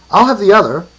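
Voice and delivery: high, loud aristocrat; aristocratic voice. A narrator's reading. Aristocrat claims the other eyeball, aristocratic voice. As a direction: call out like an aristocrat. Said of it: "came an aristocratic voice".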